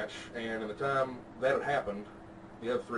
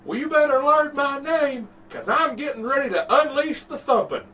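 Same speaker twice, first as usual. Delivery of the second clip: put-on crazy old man threat; 14 semitones higher, 10 dB louder than usual. Quoting the old man's absurd threat to podcast friends, hammed-up, much higher and louder. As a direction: ham up the old guy's absurd threat, drawing out the first words. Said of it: "he said the phrase that I've never heard before".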